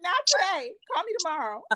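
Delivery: high, brushing-off not today; mock dismissive. Imagining answering the boss's bad-news call, mock dismissive. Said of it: "I'm like".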